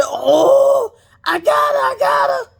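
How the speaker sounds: high, gloating shout; triumphant mock shout; gleeful gloat. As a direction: crow it out like you caught her. [name] gloating over catching Kandi.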